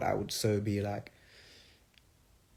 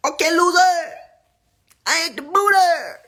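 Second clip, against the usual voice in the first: much higher, loud put-on quote voice; 12 dB louder than usual. Asked about [name], quoting her in a high loud sassy voice. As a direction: fling it high like a sassy diva.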